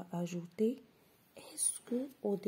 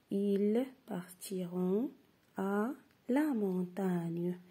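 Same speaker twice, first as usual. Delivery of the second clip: slow, careful reading; slow dictation; slower than usual. Shown as a demonstration. Teacher reading an example sentence to pupils, slowly. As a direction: read each word slowly. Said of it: "troisième phrase".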